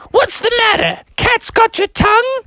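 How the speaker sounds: high falsetto teasing teacher; Mother Goose. Acted. Teacher prods reluctant pupil, high teasing goose. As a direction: prod the shy pupil in a high voice. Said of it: "And the teacher's like".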